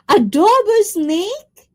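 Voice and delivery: disbelieving exclamation. Real, not acted. Disbelief at the food.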